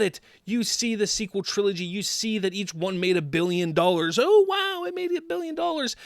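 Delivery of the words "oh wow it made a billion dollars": high, fast mock amazement; sarcastic awe. Mocking the studio's billion-dollar pride, high sarcastic awe. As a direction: gush with fake wonder.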